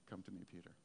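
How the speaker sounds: very soft, low call; a hushed, gentle summons; Jesus. Acted. Preacher voicing the call to Peter on the water, hushed and low. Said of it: "the voice of Jesus saying".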